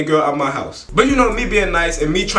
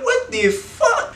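shocked disbelief; slower than usual. Retold or imitated. Shocked at her whining.

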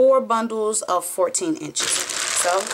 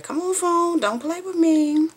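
high, exasperated plea to the phone; a high, fed-up plea to a phone that won't cooperate; pleading with phone; 10 semitones higher than usual. Real, not acted. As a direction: coax the phone, half annoyed.

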